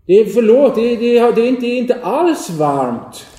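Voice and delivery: high, loud stammering apology; flustered shouting. Acted. Boy calling after Anton, loud flustered apology. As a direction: shout after him, tripping over the words. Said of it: "Nu ropar han".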